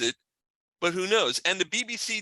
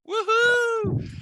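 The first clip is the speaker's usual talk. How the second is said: excited cheer; 5 dB louder than usual. Real, not acted. Cheering a big announcement.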